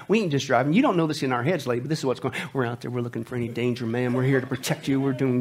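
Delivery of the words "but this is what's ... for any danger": ordinary delivery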